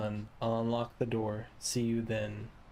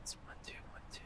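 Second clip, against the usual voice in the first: whispering; 17 dB quieter, much faster than usual. Real, not acted. Counting in a whisper.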